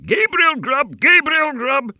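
very high, loud shriek; wild screaming chorus. A narrator's reading. Invisible voices taunt Gabriel, high wild screaming. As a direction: scream it like a wild goblin mob. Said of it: "exclaimed the wild voices again".

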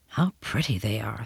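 low, soft inner voice; private thought. A narrator's reading. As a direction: murmur it to yourself, admiringly. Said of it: "thought Winterbourne".

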